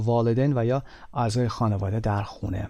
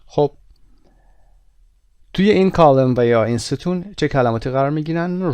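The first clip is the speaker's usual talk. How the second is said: usual speaking voice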